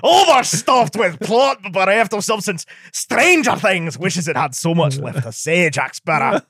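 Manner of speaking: slow, stretched, hammed-up Scottish; mock Scottish accent. Host parodying the reviewer's review, Scottish accent. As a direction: read the review in exaggerated drawn-out Scottish. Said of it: "Time Magazine is a Scottish production".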